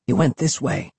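usual speaking voice

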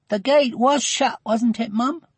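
a hint of a worried little girl; high, questioning child's voice; lightly voiced anxious girl. A narrator's reading. Worried girl asks her mother.

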